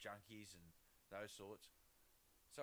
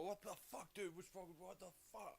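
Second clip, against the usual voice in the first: higher-pitched imitation of panicked strangers; put-on startled outburst, pitched up; mock freak-out; 7 semitones higher than usual. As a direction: mimic people freaking out over nothing.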